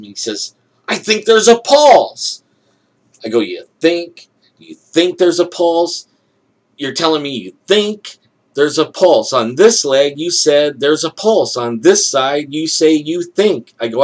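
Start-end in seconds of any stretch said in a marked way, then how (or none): 0.9-2.4 high, loud, slow announcement; loud doctor's proclamation. Retold or imitated. Doctor checking the other ankle, loud high proclamation. 3.4-13.7 higher, louder, leaning hard on THINK; incredulous challenge. Retold or imitated. Patient challenging doctor over a pulse, incredulous and emphatic.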